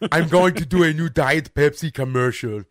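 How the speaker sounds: loud, slow, heavy mock Schwarzenegger accent; hammed-up Austrian strongman accent, drawn out; Sofia Vergara as Schwarzenegger. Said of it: "I hear Arnold Schwarzenegger".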